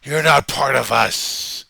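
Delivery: slow, loud preacher rant; slow, loud, threatening preacher tirade; practicing a speech. Shown as a demonstration. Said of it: "let me try that again".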